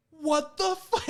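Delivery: very high, mock-screamed outburst; imitated scream. Man left at elevator door, mock screaming. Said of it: "he, like, screams".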